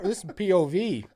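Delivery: usual speaking voice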